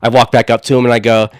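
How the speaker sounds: normal talking voice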